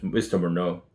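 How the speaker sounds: ordinary delivery, nothing marked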